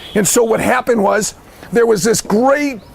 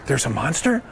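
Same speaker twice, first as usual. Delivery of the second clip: low, puzzled question; hushed-down, disbelieving storybook question; taken aback; 8 semitones lower, 8 dB quieter than usual. Acted. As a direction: ask it low, as if it's just sinking in. Frog hears news of monster.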